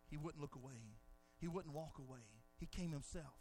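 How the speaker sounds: tender quiet preaching over music; hushed. Real, not acted. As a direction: draw them in with a near hush. Hushed pulpit drop.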